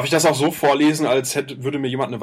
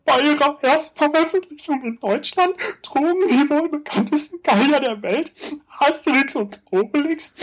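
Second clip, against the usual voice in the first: high, tense voice under threat; scared; 10 semitones higher than usual. Acted. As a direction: read it like someone is forcing you. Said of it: "Als ob dir jemand eine Waffe an den Kopf hält".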